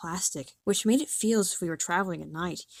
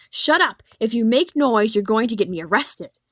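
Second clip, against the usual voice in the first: angry shouting; 5 dB louder than usual. Retold or imitated. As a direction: bark it down the aisle at the passengers. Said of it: "hitting people with his fist and shouting".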